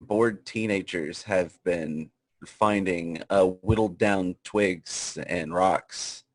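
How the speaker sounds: slow, solemn documentary narration; hammed-up nature doc voice; mock documentary narrator.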